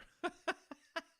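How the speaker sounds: a high, loud cackle that bursts out at the punchline; burst of laughter. Real, not acted. Cracking up at his own re-entry joke, loud high laughter. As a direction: throw your head back and howl with laughter.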